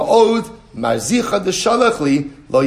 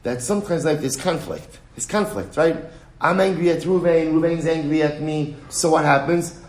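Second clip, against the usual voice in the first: normal talking voice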